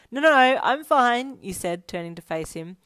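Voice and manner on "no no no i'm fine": loud, high and hurried reassurance; flustered denial. A narrator's reading. Brushing off a worried friend, loud and flustered. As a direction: burst out insisting all is well.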